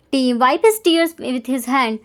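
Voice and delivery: ordinary delivery, nothing marked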